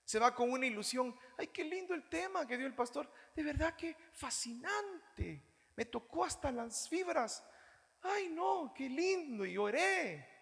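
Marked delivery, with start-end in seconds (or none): 1.4-10.3 high, soft mock gushing over the sermon; gushing churchgoer. Pastor mocking a churchgoer moved by a sermon, high gushing mock voice.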